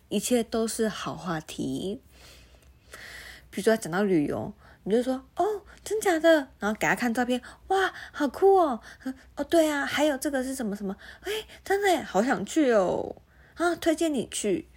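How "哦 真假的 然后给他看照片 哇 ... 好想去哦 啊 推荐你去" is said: high gushing small talk; fake enthusiasm. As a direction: gush like a fake-excited coworker.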